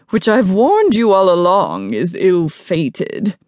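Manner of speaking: slow, imperious warning; haughty disapproval. Acted. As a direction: warn loftily, drawing the words out. Arrogant guest disapproves of the romance.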